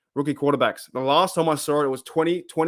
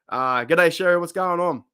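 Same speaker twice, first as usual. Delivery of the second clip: ordinary delivery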